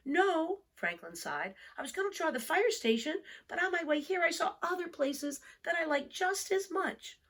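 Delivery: put-on an undecided young turtle explaining; Franklin's child voice. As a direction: let it rise, a bit worried.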